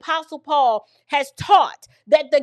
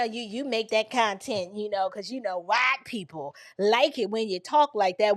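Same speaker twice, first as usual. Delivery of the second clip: lower, softer voice for quoted critics; imitating critics; 6 semitones lower, 5 dB quieter than usual. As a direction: quote the detractors low and quiet, in passing. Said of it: "Talking about, you know".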